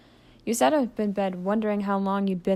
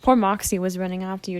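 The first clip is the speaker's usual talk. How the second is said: ordinary delivery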